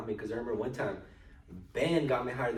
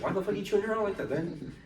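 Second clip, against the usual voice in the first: angry; 10 semitones higher than usual. Retold or imitated. Confronting dad in a quarrel.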